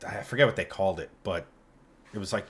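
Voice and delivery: usual speaking voice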